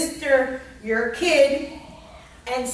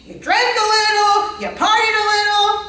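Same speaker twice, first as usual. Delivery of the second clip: 1 playful singsong; 6 semitones higher, 6 dB louder than usual. Pretend. Imagining partying in the Keys.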